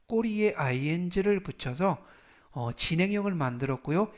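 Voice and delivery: ordinary talk, nothing marked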